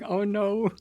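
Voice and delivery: imitating an Australian; Australian accent. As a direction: say "Oh no" with an Australian lilt. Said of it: "sound like Australians saying".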